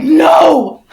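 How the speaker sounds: loud, high refusal; emphatic no. Retold or imitated. Refusing the friend's invitation, loud and high. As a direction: shout the no. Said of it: "I'm like".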